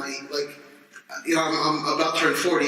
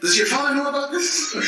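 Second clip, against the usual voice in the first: much higher, scolding disbelief; mock scandalized official; 10 semitones higher than usual. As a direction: ask it like an appalled administrator. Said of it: "he's like".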